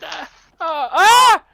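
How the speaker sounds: high, loud panicked yelp; kobold squeal. Acted. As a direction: shriek as the maul swings past. Kobold cries out as attacked.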